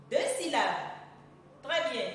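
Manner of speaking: usual speaking voice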